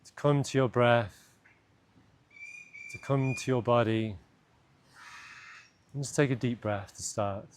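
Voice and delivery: calm, unhurried meditation voice with long pauses; guided meditation. Acted. Guide leading listeners into an imagined cave, guided meditation. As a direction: lead them gently inward, leaving space between phrases.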